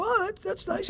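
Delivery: high and soft "that's nice"; mock soft approval. Listening at his first meeting, softly touched. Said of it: "I go".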